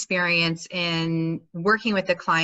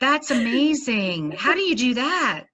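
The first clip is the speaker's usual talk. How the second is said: amazed; 6 semitones higher than usual. Real, not acted. Host watching a therapist's screen trick, amazed. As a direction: marvel at it, pitched up high.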